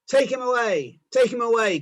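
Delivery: high, loud furious yell; frenzied crowd shout. A narrator's reading. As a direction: scream it at Pilate. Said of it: "they shouted".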